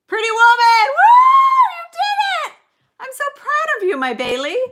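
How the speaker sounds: high, loud whoop easing into proud delight; excited cheer. Real, not acted. Cheering a right answer in trivia.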